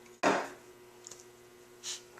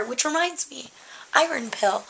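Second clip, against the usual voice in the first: ordinary talk, nothing marked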